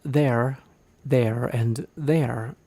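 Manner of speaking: there, and there said very slowly, each there stretched; slow, drawn-out demo. Shown as a demonstration. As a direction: draw out each there evenly.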